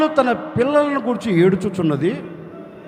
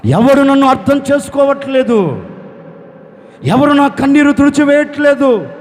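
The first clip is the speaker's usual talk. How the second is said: higher, louder, heart-broken cry to God; wailing lament; 6 semitones higher, 5 dB louder than usual. Acted. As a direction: pour out a mother's anguished lament. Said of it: "గుండెలో బాదుకుని రోధిస్తుంది". (రాహేలు దేవునితో రోదిస్తూ మొరపెట్టడం)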